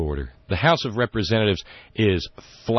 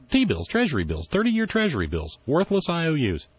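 high mocking; 9 semitones higher than usual. Real, not acted. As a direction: voice jumps high in scorn. Mocking the worthless claim.

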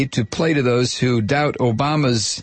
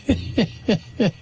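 mocking laugh; 7 semitones higher, 3 dB quieter than usual. Real, not acted. Laughs at quoted remark.

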